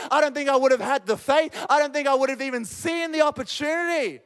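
impassioned preacher in full flow; fervent preaching. Real, not acted. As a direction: drive it home to the congregation. Preaches at rising force.

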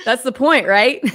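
loud, laughing reply; tickled, breaking into laughter; amused, laughing. Real, not acted. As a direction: say it through a real laugh. Hosts laugh over being excited.

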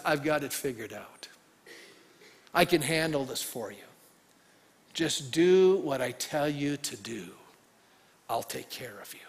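a hint of a calm, reassuring God; gentle God. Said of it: "God goes".

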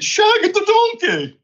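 high, drawn-out joke proclamation; mock grand announcement. Panellist teasing the host with a joke website, mock-announcing. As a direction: stretch the name out high like a big reveal.

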